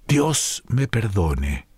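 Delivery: plain reading voice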